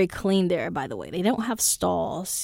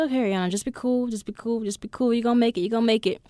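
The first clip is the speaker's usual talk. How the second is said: quick, slightly higher self-coaching; self pep talk; 3 semitones higher, much faster than usual. Retold or imitated. Nervous traveler coaching herself through the airport, hurried pep talk. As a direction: rattle off reassurances to yourself. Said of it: "So I was like".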